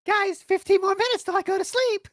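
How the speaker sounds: very high squeaky pet voice; begging virtual-pet streamer. Pretend. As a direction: beg for tips in a tiny high voice. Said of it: "imagine if he was like".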